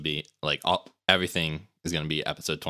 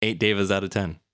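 ordinary talk, nothing marked

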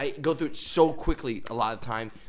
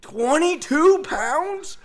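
"Twenty-two pounds?" much higher, very slow, rising; outraged disbelief; 8 dB louder, much slower than usual. Retold or imitated. Player telling friends the coach's target, high squeal of disbelief. Repeating it, even more stunned.

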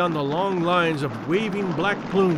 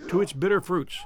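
ordinary delivery

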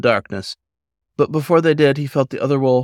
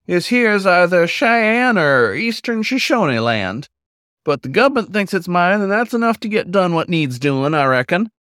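put-on a leisurely folksy old wolf explaining; 7 semitones higher than usual. Old wolf explains the land to Paul, high and folksy.

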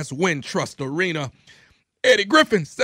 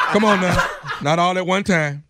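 laughing; 7 semitones higher, 4 dB louder than usual. Real, not acted. Host laughing at prank reveal.

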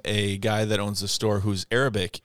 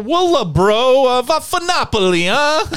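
high, loud, drawn-out mock Italian and Arabic; mock Arabic-Italian accent; 14 semitones higher, 7 dB louder, slower than usual. Bob testing which language he bites on, loud Arabic-Italian accent. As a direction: belt out hammed-up Italian-Arabic gibberish. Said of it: "insert some Arabic and some Italian".

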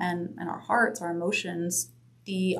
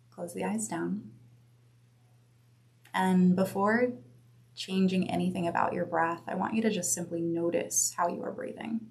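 guided meditation; 3 dB quieter than usual. Acted. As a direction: guide them gently inward. Leads a breath meditation.

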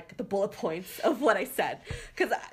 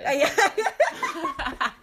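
high, loud laugh through the yeah; 13 semitones higher, 5 dB louder than usual. Real, not acted. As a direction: crack up while agreeing. Laughing at the Lyft joke.

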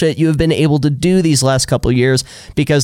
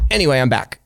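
ordinary talk, nothing marked

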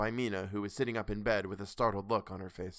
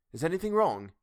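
sudden alarmed question, louder; 7 semitones higher, 5 dB louder than usual. A narrator's reading. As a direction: ask it in alarm. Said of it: "he asked in alarm".

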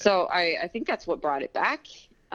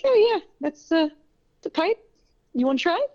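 high, bright-pitched mock offer; high sing-song offer; 10 semitones higher, 4 dB louder than usual. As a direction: pitch it way up like a playful tease. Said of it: "so I'll just be like".

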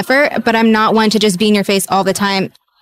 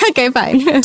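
laughing through it, mock resigned; laughing resignation; 4 semitones higher, slower than usual. Real, not acted. Guest laughing off her crying scene being cut. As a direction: shrug it off with a laugh.

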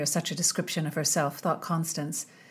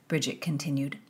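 ordinary delivery, nothing marked

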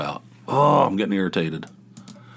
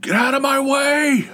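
high, loud panicked shout to clear the path; frantic shout; 4 dB louder than usual. Retold or imitated. Mocking people racing to the toilet, high frantic shout. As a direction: yell like you must reach the bathroom now. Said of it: "They're talking, like".

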